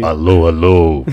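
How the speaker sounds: low, slow mic-check voice; radio announcer voice. Pretend. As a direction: test the mic like a deep-voiced radio host.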